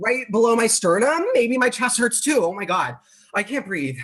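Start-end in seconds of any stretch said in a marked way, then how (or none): 1.3-4.0 breathless, rising panic, pitched up high; gasping out words in a panic; panicking. Acted. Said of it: "it sounds like you're panicking".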